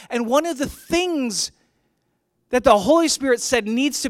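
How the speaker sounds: high, forceful preacher delivery; emphatic preaching. Real, not acted. Pastor marvelling at Stephanas in the Bible, emphatic preaching. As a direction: proclaim it with rising conviction.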